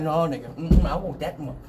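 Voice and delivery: softly grunted dog refusal; mumbled stubborn animal voice. Retold or imitated. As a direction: imitate a dog resisting with whiny hums. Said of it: "The dog like".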